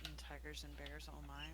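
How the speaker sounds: very soft, hushed questioning; whisper. Acted. Amanet asks the unseen party what's going on, hushed whisper. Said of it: "Amanet's gonna whisper".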